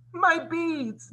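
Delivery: very high story-time shriek. A narrator's reading. Drizella spots her beads on Cinderella, shrieking high. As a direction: shriek in acted outrage. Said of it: "Drizella shrieked".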